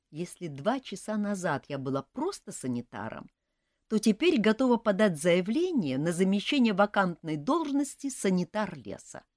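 plain reading voice

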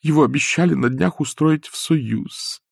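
робкая, выше обычного просьба; проси неуверенно, с запинками; робко. A narrator's reading. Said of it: "сказала она робея". (робко, выше и тише)

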